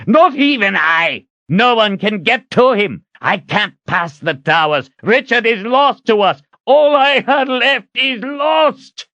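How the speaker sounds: high, anguished furious cries; out-of-control rage. A narrator's reading. Zed despairing over Richard's loss, raging. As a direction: rage helplessly, drawing out the last words. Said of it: "a wizard in a rage so out of control".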